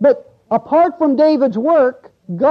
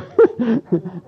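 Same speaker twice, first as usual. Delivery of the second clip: embarrassed chuckle. Real, not acted. Preacher laughs at his slip.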